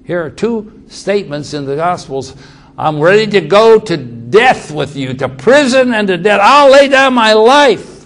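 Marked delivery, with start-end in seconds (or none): 2.8-7.9 building up higher and louder to the last line; fervent vow. Retold or imitated. Peter vowing to die for Jesus, building loud and high. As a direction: swear it, rising to a loud peak.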